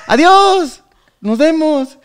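high, loud farewell call; called-out goodbye. Acted. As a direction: sing out a goodbye to someone across the way. Acted farewell called to cemetery worker.

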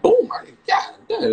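high, loud reenacted shock; acted astonishment. Streamer retelling his reaction to Shelly's picture, astonished. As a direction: blurt it high, as if floored.

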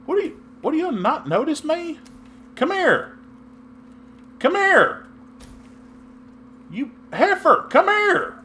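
very high pleading "Come here!"; frustrated yelling. Real, not acted. Gamer calling at an oblivious teammate, high frustrated yells. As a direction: shout to get her attention.

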